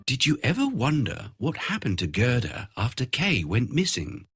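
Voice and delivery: ordinary delivery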